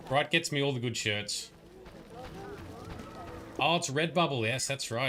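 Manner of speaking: usual speaking voice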